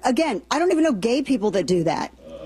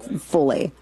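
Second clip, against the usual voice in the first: usual speaking voice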